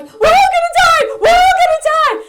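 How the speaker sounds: put-on high-pitched, full-force panicked child's shriek; daughter screaming. Mother acts out daughter waking on the plane, screaming. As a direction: shriek it out like a terrified kid. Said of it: "she starts screaming".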